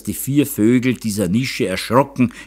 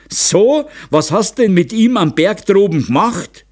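high, loud accusing questions; angry interrogation; 9 semitones higher, 5 dB louder than usual. A narrator's reading. Father interrogates daughter about the mountain, angry. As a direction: press her for a confession.

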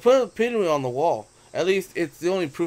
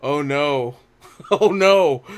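laughing dismay; 8 dB louder, slower than usual. Real, not acted. Embarrassing photo about to show.

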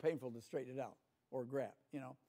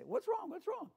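much higher, anxious parent voice; mock worried parent; 4 dB louder than usual. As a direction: fret over the crying baby, pitched way up. Parent answers the crying baby.

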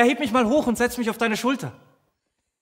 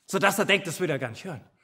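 usual speaking voice

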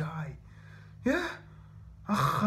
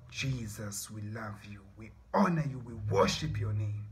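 reverent prayer; 8 semitones lower than usual. Real, not acted. Praying to Jesus in worship, low and reverent.

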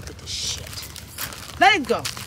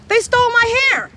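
high, loud indignant cry; outraged shout; 8 semitones higher, 11 dB louder than usual. Real, not acted. Owner complaining to the camera about her dogs, loud outrage. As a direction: call out the theft in outrage.

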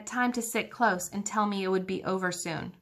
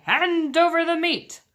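high, loud greedy demand; captain demanding meat at the table; demanding pirate; 8 semitones higher, 4 dB louder than usual. A narrator's reading. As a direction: bark for the meat. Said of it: "Braidbeard gulped his food and said".

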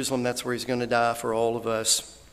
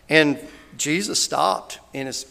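ordinary delivery

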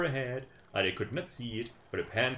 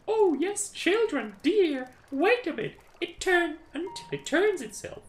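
high, coaxing mother voice; mother answering; 6 dB louder than usual. Acted. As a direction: soothe the hungry children. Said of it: "she answered".

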